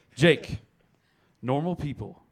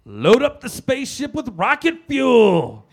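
wrestler promo; 10 semitones higher, 9 dB louder, slower than usual. Acted. Quoting wrestler promo line.

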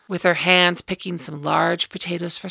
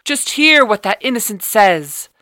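loud; 4 semitones higher, 9 dB louder than usual. A narrator's reading. Father speaks up in crowd.

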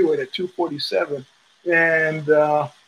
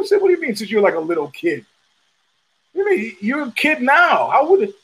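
mock incredulous; 6 semitones higher than usual. Mock high quote to fighter.

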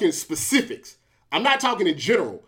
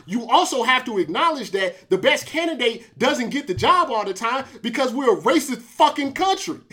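usual speaking voice

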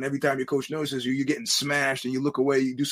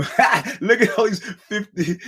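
mocking laugh; 7 semitones higher, 5 dB louder, slower than usual. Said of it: "I was about to go".

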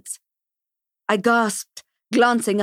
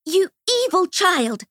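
high, slow, harsh scold; a stern woman condemning a boy; furious scolding; 9 semitones higher, slower than usual. A narrator's reading. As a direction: spit it at the child. Ruth Ann scolding the boy.

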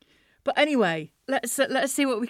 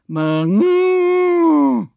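loud high moo; cow moo; 6 semitones higher, 9 dB louder than usual. Shown as a demonstration. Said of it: "various moos".

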